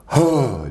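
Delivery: a long forceful breath out; hard exhale. Shown as a demonstration. Demonstrating the exhale again to the host, forceful breath out. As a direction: push the breath out hard as a demo. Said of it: "Just breathe out real hard".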